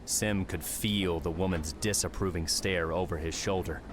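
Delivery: usual speaking voice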